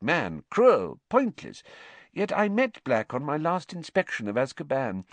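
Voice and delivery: acted official, animated and disturbed; unnerved official. A narrator's reading. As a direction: recount it with mounting astonishment. Story figure Fudge, astonished account.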